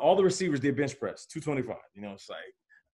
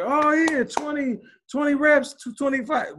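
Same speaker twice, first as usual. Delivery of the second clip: much higher, mock amazed cheering; teammates' excited cheer; 12 semitones higher, 3 dB louder than usual. Teammates cheering his bench reps, high excited mock cheer. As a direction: hype up the big bench number like stunned teammates. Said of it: "everybody's like".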